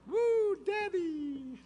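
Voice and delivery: put-on high, slow little kid cheering; high drawn-out child's cheer. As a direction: squeal it like a happy toddler. Child voiced in story.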